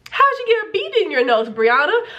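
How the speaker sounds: high-pitched, indiscreet teacher question; imitated blurting out. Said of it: "she busts me out and goes".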